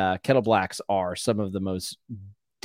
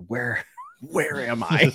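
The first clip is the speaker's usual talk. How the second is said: high, slow, dazed with a laugh; groggy and confused; 6 semitones higher, slower than usual. Retold or imitated. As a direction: sound half awake and lost. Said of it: "I'm like".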